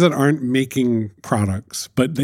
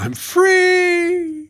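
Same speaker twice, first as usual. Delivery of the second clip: joyful shout. Real, not acted. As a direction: shout it out with relief. Celebrating quitting as CEO.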